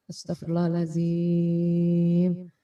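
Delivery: very slow, drawn-out astagfirullahaladzim; slow istighfar demo. Shown as a demonstration. Teacher demonstrating breathing out through the dhikr, slow and drawn out. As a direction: stretch every syllable as one long breath out. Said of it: "dilafazkan secara berbisik ya".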